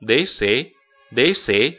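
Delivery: "this it" as one sound; linking this it. Shown as a demonstration. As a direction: slide "this" into "it". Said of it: "This跟it要连读". ("this it"连读)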